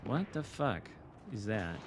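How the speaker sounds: ordinary talk, nothing marked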